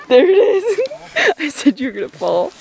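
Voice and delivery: talking through laughter; gloating while laughing. Real, not acted. Laughing at friend's fall.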